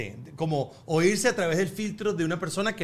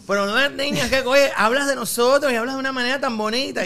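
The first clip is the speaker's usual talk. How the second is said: loud, higher gushing fan; imitated fan praise, pitched up; imitated admiring fan; 7 semitones higher, 6 dB louder than usual. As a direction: act out an effusive compliment. Acts out admiring Cuban fans.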